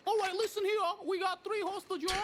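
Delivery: very high, squeaky put-on British voice. A robber to hostages in a squeaky high British voice. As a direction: crank the voice way up like a cartoon crook. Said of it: "all you did was make your voice higher".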